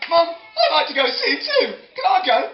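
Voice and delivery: put-on high, loud, eager child voice; little boy. Little boy asking his mum, high and loud. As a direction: pipe up like a small boy. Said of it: "this little boy said".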